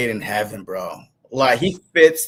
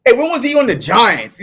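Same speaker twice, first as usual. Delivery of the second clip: mock surprise; 9 semitones higher than usual. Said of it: "But I'm like".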